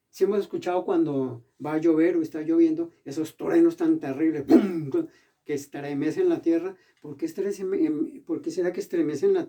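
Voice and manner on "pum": a short loud booming pum for the thunder; mock thunder crash. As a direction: punch it out like a thunderclap.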